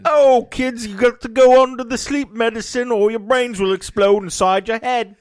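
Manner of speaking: high, loud sing-song dad voice; mock cheery dad. Father telling his kids the jaunt rules, high and chipper. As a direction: explain sweetly like a chipper father.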